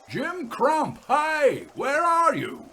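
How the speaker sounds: high call across the dark; shouting. A narrator's reading. Harry calling up to the signalman's hut, shouting. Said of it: "he shouted".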